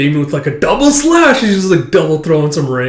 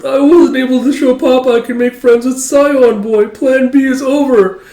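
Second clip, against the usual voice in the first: put-on much higher, louder teary kid; tearful little girl; 11 semitones higher, 6 dB louder than usual. Anya about to cry over her failed plan, high teary voice. Starting to tear up.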